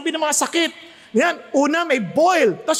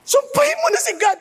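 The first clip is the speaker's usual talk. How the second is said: high, fast scolding; wife angrily telling Job to give up; angry wife; 10 semitones higher, faster than usual. Retold or imitated. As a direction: snap at him to curse God. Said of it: "Kasi galit ni asawa eh".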